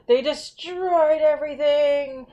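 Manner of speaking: high, drawn-out mock dismay; outraged child. Babysitter retells kid's leprechaun report, dramatic dismay. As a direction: stretch it out like a scandalized kid. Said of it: "so he was like".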